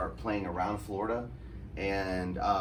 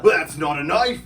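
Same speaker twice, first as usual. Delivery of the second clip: very loud, higher mock Australian accent; Crocodile Dundee; 5 semitones higher, 11 dB louder than usual. Repeating the film bit to the knife maker, Australian accent. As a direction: push the Aussie movie line, loud. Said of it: "the bit I'm doing right now".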